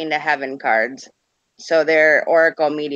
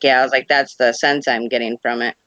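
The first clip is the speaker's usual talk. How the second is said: normal talking voice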